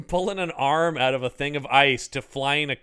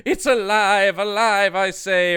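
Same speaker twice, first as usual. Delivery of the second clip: louder, higher triumphant cry; mad scientist cry; 5 semitones higher, 5 dB louder than usual. Retold or imitated. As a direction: proclaim it like a mad doctor. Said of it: "There's no".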